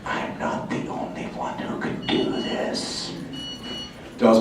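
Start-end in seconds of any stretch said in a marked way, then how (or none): 0.1-3.1 slow, soft, unvoiced-sounding whisper; hushed, drawn-out stage whisper; awed whisper. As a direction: whisper it slowly, like a secret revelation. Imitating a realization in whisper.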